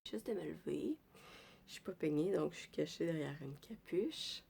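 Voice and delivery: low croaky just-woke-up voice; sleepy rough morning voice. Real, not acted. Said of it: "j'ai ma voix du matin".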